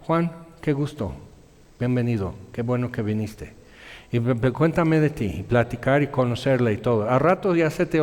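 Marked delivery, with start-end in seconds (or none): 0.1-5.2 warm welcome. Shown as a demonstration.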